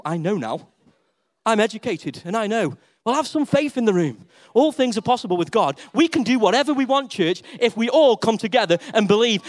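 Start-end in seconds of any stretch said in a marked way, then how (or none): none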